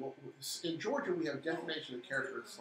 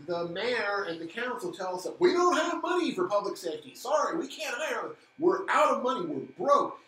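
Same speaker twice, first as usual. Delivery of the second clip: raised, angry ranting voice; heated rant; 6 semitones higher, 7 dB louder than usual. Real, not acted. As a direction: rail against the council. Ranting about city money.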